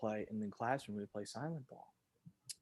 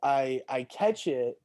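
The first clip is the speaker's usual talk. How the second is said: ordinary talk, nothing marked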